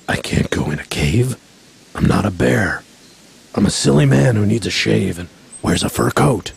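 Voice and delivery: low, resigned bear voice. A narrator's reading. Bear gives in, convinced he's man.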